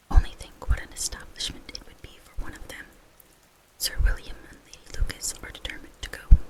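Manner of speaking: usual speaking voice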